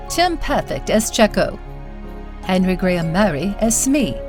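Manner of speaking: usual speaking voice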